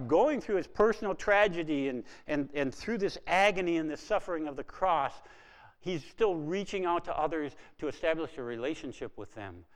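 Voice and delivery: usual speaking voice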